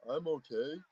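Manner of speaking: low, soft mock voice; imitating the fighter. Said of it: "So he's like".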